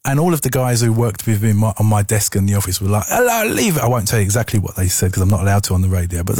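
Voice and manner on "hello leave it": mocking coworkers.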